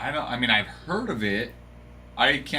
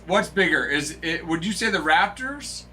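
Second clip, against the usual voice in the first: ordinary talk, nothing marked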